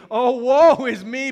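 high, very loud, drawn-out cry of woe; loud lament. Acted. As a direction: cry it out slowly to the congregation. Preached cry of awe before God.

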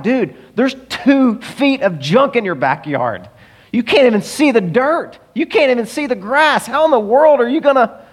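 high, incredulous pleading; exasperated disbelief. Acted. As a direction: reason with him in mounting, acted exasperation. Exasperated team argues with hoarder.

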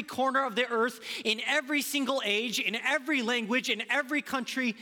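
loud, high preaching build, repeating every; full-force preaching. Acted. Preacher to congregation, proclaiming loudly with rising fervor. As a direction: preach it out to the whole room, ringing.

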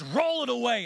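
loud firm command; Jesus ordering the stone rolled away, loud. Acted.

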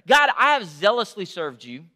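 loud, rising angry protest; anger. Acted. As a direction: hurl the protest at God. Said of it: "He starts off with anger".

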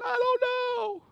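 very high wailing answer; mock crying. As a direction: imitate a bawling child. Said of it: "why are you crying".

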